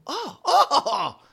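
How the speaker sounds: high excited squeal; high giddy yelp; Thaddeus squealing. Acted.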